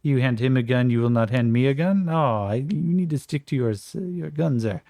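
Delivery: mock-offended Frenchman demanding fairness; French accent. Jean-Francois protests to the guard in a light French accent. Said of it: "Jean-Pierre goes".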